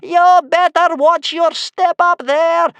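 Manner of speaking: very high, loud, drawn-out howl; furious old woman's slow shriek; screaming grandmother. A narrator's reading. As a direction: scream it up at the ceiling like a wolf. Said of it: "screamed Bear Cub's grandmother".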